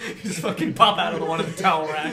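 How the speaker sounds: fast, loud, through laughter; laughing shout. Real, not acted. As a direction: yell it cracking up. Laughing at intruder.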